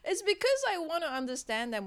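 words through high laughter; laughing. Real, not acted. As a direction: laugh while explaining. Laughing at her own confession.

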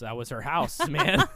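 laughing mock cop line; laughing quote. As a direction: laugh through the line as it builds. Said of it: "And he goes".

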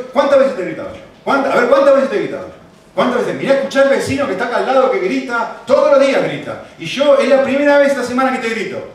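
acted marital self-defence; indignant husband. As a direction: argue back heatedly, building in force. Husband defending himself in quarrel.

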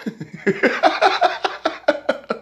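high airy laugh; breathy mocking laugh. Real, not acted. Laughing at the tough guy called a baby, airy mocking laugh. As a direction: laugh it off in scorn. Laughs at his own insult.

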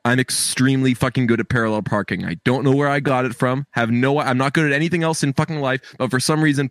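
normal talking voice

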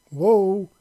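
startled cry of panic; panicked exclaim. A narrator's reading. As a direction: yelp it as you start to fall. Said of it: "he exclaimed in panic".

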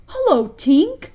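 loud, drawn-out greeting with a rise; wondering cry. A narrator's reading. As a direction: hail the fairy in wonder. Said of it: "cried the wondering boys".

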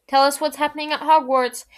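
put-on shouted demand from a boy; bellowing. A narrator's reading. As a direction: call out loudly, demanding an answer. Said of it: "Fred bellowed".

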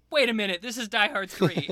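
imitating a friend's eureka moment; realization. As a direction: blurt it out as it clicks. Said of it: "Kane was just like".

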